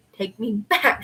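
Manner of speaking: plain reading voice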